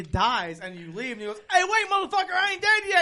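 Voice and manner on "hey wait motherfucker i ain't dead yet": high, louder, laughing mock voice; imitating a dying game character calling the player back; mock alien yell. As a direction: holler it as a not-quite-dead alien.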